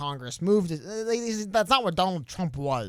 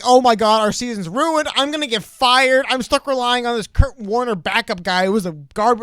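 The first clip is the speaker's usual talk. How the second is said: loud, higher-pitched exasperated despair; mock panic; 4 semitones higher, 6 dB louder than usual. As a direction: play a coach panicking over a ruined season. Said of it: "I mean, just like".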